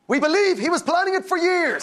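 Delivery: high, solemn mock statement; quoted zookeeper. Zookeeper telling the paper about the escape, high mock statement. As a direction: announce it high, as the punchline. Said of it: "his zookeeper said".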